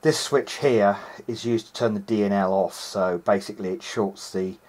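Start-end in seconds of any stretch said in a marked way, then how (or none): none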